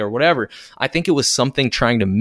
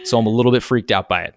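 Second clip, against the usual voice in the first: ordinary talk, nothing marked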